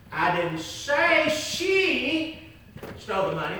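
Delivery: "she" lifted and held; "she" set apart with a rise; stress on she. Shown as a demonstration. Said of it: "Let me say it in different ways".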